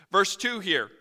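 ordinary talk

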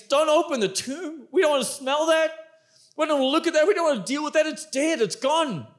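don't make us look; Martha pleading. Acted. Martha's heart begs Jesus not to open tomb, mildly pleading. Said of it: "Martha's heart was saying".

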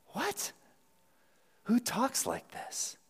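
very high, hushed incredulity; squeaky, stunned question, drawn out slowly; baffled disbelief. Acted. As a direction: play a disciple utterly bewildered. Acting baffled reaction to Jesus's words.

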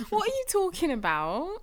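high put-on character voice; changed voice. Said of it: "Can you change your voice?".

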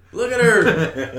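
high, loud, slow laughing awe; excited. Retold or imitated. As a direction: marvel at her, laughing. Gushing over Daenerys.

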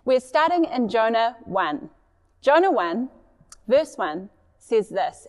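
normal talking voice

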